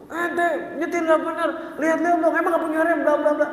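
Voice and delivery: hammed-up road-rage scolding; mock cursing driver. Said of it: "Maki-makian dulu tuh". (pura-pura memaki sopir)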